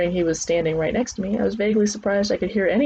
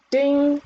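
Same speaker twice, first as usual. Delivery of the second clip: high, ringing "ding"; imitated bell ding; 8 semitones higher, 3 dB louder than usual. A narrator's reading. Narrator voicing the round bell, high ding. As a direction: sound the bell with the voice.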